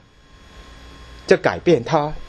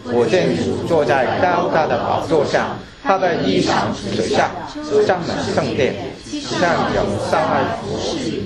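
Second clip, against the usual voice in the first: scripture reading; 2 semitones higher than usual. Acted. Reading Isaiah 6 aloud.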